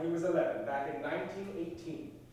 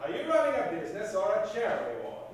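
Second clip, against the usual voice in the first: angry shouting; 6 semitones higher, 7 dB louder than usual. Acted. Potter argues with Pop.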